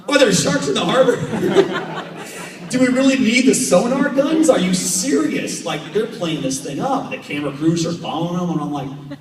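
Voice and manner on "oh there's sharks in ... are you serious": high, loud, laughing disbelief; mock alarm. Mocking the shark hype to the interviewer with high laughing disbelief. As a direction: squeal it in laughing mock panic.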